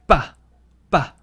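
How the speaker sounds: ba with a tight, hard start; forceful ba. Shown as a demonstration. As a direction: push out ba with extra force. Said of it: "you're using more force".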